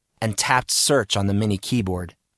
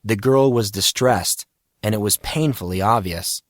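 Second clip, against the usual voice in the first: plain reading voice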